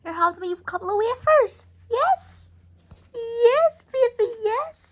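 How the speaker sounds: very high, sing-song coo; a mother cooing very high at her son over his wafers. Real, not acted. Cooing to the baby.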